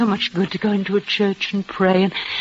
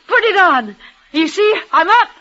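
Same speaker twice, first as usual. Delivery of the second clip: high, straining to stay up; desperate insistence; 9 semitones higher than usual. Acted. Dying woman insists to her lover she is well, desperately. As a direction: fight the weakness, pleading.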